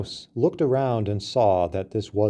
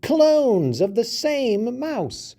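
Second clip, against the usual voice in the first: high, squeaky voice of a little mouse; a small mouse scolding a big elephant; higher mouse voice, slowing on key words; indignant mouse; 9 semitones higher than usual. A narrator's reading.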